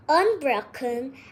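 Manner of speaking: plain reading voice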